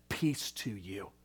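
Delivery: low, slow and soft; gentle peace. Acted. Christ speaking to the sinner, low and gentle. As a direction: lay the words down tenderly. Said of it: "and he says".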